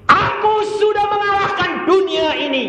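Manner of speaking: high, loud preacher's declamation; triumphant proclamation. Acted. Preacher quotes Jesus overcoming the world, loudly proclaimed. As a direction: proclaim it at full pulpit force.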